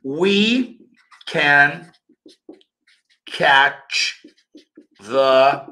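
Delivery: We, can, catch, the with gaps; each word set apart. Shown as a demonstration. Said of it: "mas era aqui, ó".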